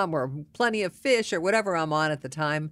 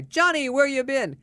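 high, louder mock hail; hearty greeting; 9 semitones higher, 5 dB louder than usual. Passerby greeting a friend at the bar, hearty call. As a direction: imitate a pal calling out to a regular. Another regular hails the man.

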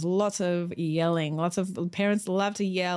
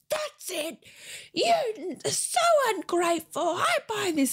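high, strained scream held back in volume; mock mum scream; 13 semitones higher, slower than usual. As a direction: imitate a fed-up mum screaming at her kid. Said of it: "listened to her mum scream".

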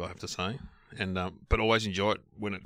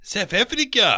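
put-on South African accent, higher and louder; 9 semitones higher, 5 dB louder, slower than usual. Echoing the co-host's answer in surprise, South African accent. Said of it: "that's my South African accent".

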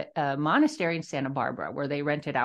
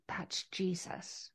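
hushed whisper, barely any voice; 13 dB quieter, much slower than usual. Retold or imitated. Realizing the comfort came from Jesus, in a whisper. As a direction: breathe it out as a stunned realization. Quiet realization after click in brain.

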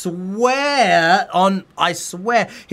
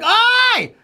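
high, louder startled cry at the thief; retold yelp; 5 dB louder than usual. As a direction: yelp at the monkey running off. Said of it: "and I'm like".

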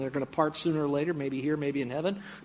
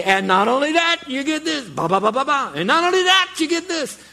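very high, loud prize pitch with sung fanfare; game show announcer; 12 semitones higher, 9 dB louder than usual. Acted. Host piling prizes on a winner, game show announcer. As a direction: top each prize with a bigger shout. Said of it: "And then they say".

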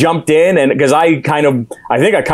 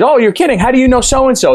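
high-pitched imitation of amazement; retold surprise; 9 semitones higher, faster than usual. Husband quoting [name] about the mutual friend, surprised. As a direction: lift the voice high in mock disbelief. Acting out [name]'s surprised reply.

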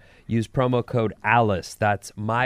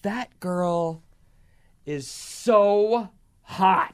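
slow, higher-pitched "so hot"; drawn-out gushing; 6 semitones higher, much slower than usual. Real, not acted. Host gushes over the girl on the show, drawn-out emphasis. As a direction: linger on "so" and "hot" with pauses before them.

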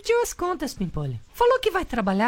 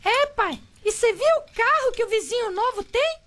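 lightly voiced teen son, higher; son Pimpolho Júnior; 8 semitones higher than usual. Son teasing his father, lightly higher voice. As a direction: son asking dad, a touch higher. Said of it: "Pimpolho Júnior".

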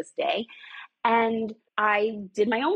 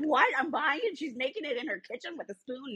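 high-pitched, amused imitation of baffled customers; mock disbelief; 6 semitones higher, 4 dB quieter than usual. Guest mimics shoppers aghast at kitchen-made products, mock incredulous. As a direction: squeak it in mock shock, trailing off softer.

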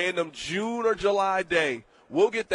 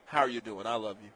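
sweet talk to wife; 7 semitones lower, 7 dB quieter, faster than usual. Retold or imitated. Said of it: "You'll be like".

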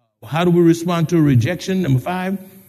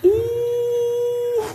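amused ooh; 5 dB quieter than usual. Retold or imitated. Reacting to crowded store.

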